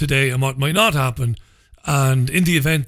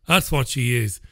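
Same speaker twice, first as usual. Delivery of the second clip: usual speaking voice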